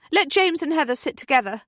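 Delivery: loud high instruction to the group; calling out. A narrator's reading. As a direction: call it over the crowd on the bus. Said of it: "Natalie calls".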